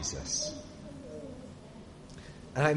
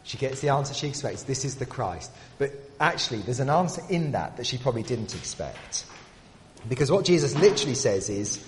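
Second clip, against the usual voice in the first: ordinary delivery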